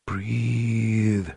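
soft, distant otherworldly voice; haunting voice. Acted. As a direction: call from far away, hollow. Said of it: "the haunting voice".

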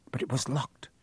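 unvoiced narration; whispered. A narrator's reading. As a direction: breathe it out as a whisper.

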